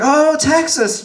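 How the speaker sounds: high, slow, thrilled "Oh, Texas"; excited. Retold or imitated. Woman thrilled to hear he's from Texas, high and stretched. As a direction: stretch it out in delight. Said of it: "she was like".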